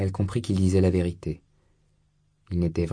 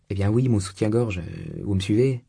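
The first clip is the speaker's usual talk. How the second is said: ordinary delivery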